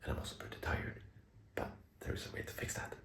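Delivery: hushed whisper so the wife sleeps. Real, not acted. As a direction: whisper softly, someone's asleep. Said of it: "I'm whispering because my wife is asleep".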